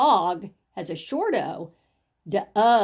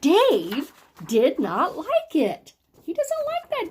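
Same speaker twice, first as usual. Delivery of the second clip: slow, emphatic, swooping exclamation; excited exclamation; 6 semitones higher, 3 dB louder, slower than usual. Shown as a demonstration. Teacher shows how an exclamation mark is read, excited. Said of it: "a little more excitement or surprise".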